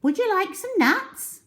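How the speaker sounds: very high, chirpy little monkey; high cheeky monkey greeting. Acted. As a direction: squeak a friendly hello up in the tree. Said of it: "the Mugglewump asked".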